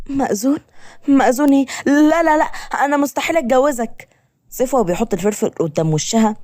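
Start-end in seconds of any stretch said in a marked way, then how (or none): 0.1-4.1 high-pitched incredulous protest; shocked refusal. Acted. Woman told she must marry her kidnapper, shocked refusal. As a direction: leap up in pitch, rapid repeated refusals.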